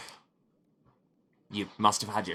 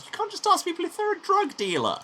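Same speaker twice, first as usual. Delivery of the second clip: incredulous high; 3 dB louder than usual. Real, not acted. Incredulous at option.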